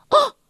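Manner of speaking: short, high-pitched startled scream. A narrator's reading. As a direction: cry out in sudden alarm. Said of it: "他发出了一阵尖叫". (短促高声的尖叫)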